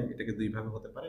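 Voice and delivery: ordinary talk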